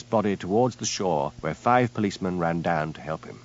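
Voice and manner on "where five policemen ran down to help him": plain reading voice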